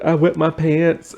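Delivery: high, slow mock wail; mock confession. Cam confessing as the tray comes off, mock wail. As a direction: draw out a hammed-up confession, pitched high.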